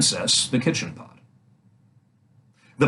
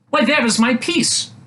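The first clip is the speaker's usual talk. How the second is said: princess's voice; 12 semitones higher than usual. A narrator's reading. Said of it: "said the princess".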